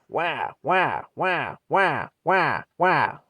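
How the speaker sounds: high, loud wailing wah wah like a cartoon baby; crying baby siren. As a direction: imitate an old ambulance siren as a crying baby. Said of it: "they'd sound this way".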